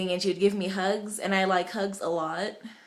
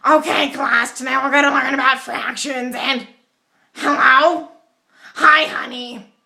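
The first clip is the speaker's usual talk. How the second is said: higher, louder put-on teacher voice; weird teacher voice; 4 semitones higher, 9 dB louder, slower than usual. Math teacher interrupting class for his wife, weird higher louder voice. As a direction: play the odd-voiced math teacher answering his phone mid-lesson. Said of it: "he had this really weird voice".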